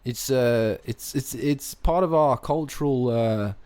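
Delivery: put-on Aussie mate; Australian accent. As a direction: answer proudly in mock Australian. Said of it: "you've sustained this accent".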